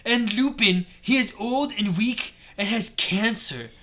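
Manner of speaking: loud, hammed-up Swedish accent; put-on Swedish accent. Cornelio Fuck rebukes students, Swedish-accented shouting. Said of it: "stuck with the Swedish accent".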